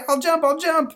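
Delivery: put-on high, loud frantic boy; panicked kid. Boy agreeing to jump in panic, very high and loud. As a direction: give in fast.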